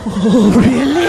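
slow seductive. Acted.

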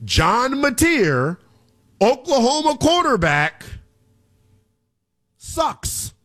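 slow, deliberate verdict in separate chunks. Shown as a demonstration. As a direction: spell it out phrase by phrase with long pauses. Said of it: "I'll say it again, very slow".

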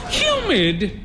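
high, incredulous echo; high questioning cry; cried query. A narrator's reading. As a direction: throw the word back in a high-pitched cry. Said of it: "she cried".

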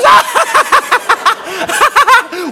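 high staccato mocking laugh; helpless high-pitched laughing fit, acted; imitated cackle. Girlfriend too amused to answer, high imitated cackle. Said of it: "And she goes".

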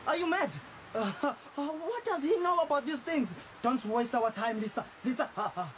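shocked, mocking laughter; incredulous scorn. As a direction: scoff at the idea, voice jumping up. She wants to see the pastor.

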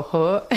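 short Ho as in Kwakwala. Shown as a demonstration. Teaching friends her Kwakwala exclamation, a short Ho. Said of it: "I always go".

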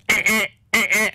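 high squeaky monkey chatter "eh, eh". Retold or imitated. The hanged monkey answers the locals, high squeaky chatter.

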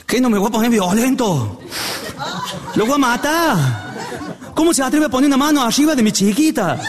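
high-pitched hammed-up fury; outraged father. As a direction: explode in comic indignation, pitch climbing. Father rages at being told calm. (padre indignado, furia exagerada)

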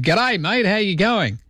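high, matey Australian hello; Aussie phone greeting. Acted. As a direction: answer the phone like a true Aussie. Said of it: "answer the phone with".

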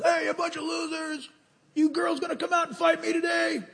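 high, mocking taunt of a giant bully; Goliath taunting. Preacher voicing Goliath taunting Israel, mocking high jeer. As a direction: jeer at the army like a swaggering giant. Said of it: "got up and yelled at Israel".

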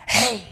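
"Hey" with a breathy rush of air from the back of the throat; Hebrew throaty hey. Shown as a demonstration. Preacher showing the congregation the Hebrew Hey, breathed from the throat. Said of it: "let the air in the back of their throat come out".

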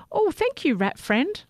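high grateful call; calling out thanks. A narrator's reading. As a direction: call back as you float away. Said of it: "Sammy called as he floated away".

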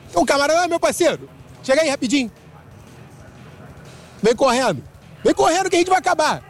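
chamando de longe. Real, not acted. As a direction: hail a stranger to hurry over. Reporter calling a passer-by over. (chamando alguém com pressa, voz mais aguda)

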